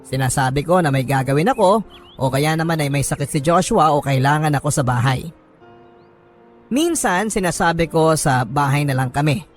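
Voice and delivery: normal talking voice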